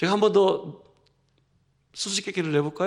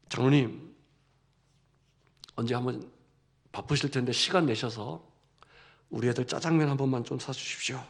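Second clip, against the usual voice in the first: much lower and softer, deferential; humble polite request; 9 semitones lower, 7 dB quieter than usual. Retold or imitated. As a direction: ask the elder gently and humbly. Pastor humbly asks the elder.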